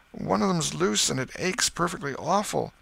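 high, whining complaint; pitiful high boy voice; plaintive boy. Acted. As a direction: whine for sympathy. Tom complains of his tooth.

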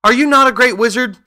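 high, loud disbelieving question; crying out. A narrator's reading. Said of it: "cried Dorothy".

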